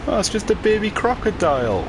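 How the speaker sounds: high amused surprise. Real, not acted. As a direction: tease the boss's size, pitched high. Sees boss, amused surprise.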